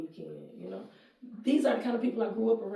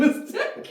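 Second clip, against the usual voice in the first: quick laugh; 7 dB louder than usual. Real, not acted. Laughing mid-sentence.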